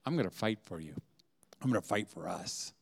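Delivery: low, soft devoted vow; tender promise. Retold or imitated. Husband reassuring his wife, low and tender. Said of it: "she wants you to say".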